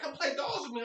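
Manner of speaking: put-on high, soft childish plea; lightly voiced little sister wanting to play. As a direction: ask sweetly like a small girl. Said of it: "She's like".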